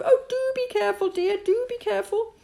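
high, fluttery anxious mother voice; a mother's high worried chorus from below; fretful mother. Acted. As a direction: chant it like a fussing mother. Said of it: "kept up a chorus of".

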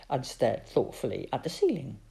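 ordinary delivery

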